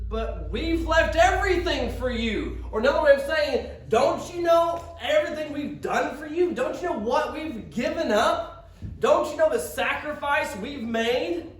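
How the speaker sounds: indignant plea. Retold or imitated. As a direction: press the sacrifice home, aggrieved. Peter's indignant plea, preached.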